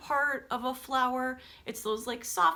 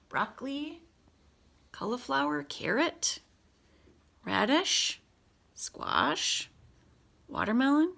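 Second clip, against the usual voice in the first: lively storybook read-aloud voice; picture-book reading with big pauses; reading to children; 3 semitones higher than usual. Acted. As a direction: read it brightly to a class of kids. Teacher reads picture book aloud.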